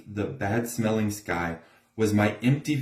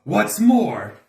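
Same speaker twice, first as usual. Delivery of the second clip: sharp, louder and higher retort; snapping; 10 semitones higher, 6 dB louder than usual. A narrator's reading. The Lorax snaps at the Onceler, louder and higher. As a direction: snap it out, dander up. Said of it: "snapped the Lorax".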